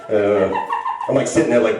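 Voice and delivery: ordinary talk, nothing marked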